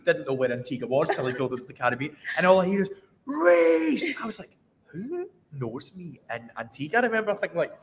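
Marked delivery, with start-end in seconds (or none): 3.3-4.1 high, drawn-out mock call; high call at ordinary volume; imitated scream. 4.9-7.0 soft, slow baffled question; hushed, slow bewilderment; puzzled undertone. Retold or imitated. Acts out puzzled private reaction.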